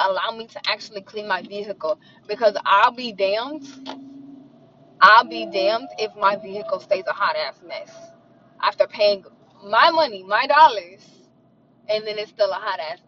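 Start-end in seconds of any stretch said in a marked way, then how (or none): none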